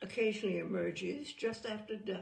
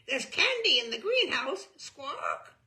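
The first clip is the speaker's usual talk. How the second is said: loud, high squawking parrot; 6 semitones higher, 7 dB louder than usual. Acted. Humphrey the parrot blurts secrets to visitors in a squawk.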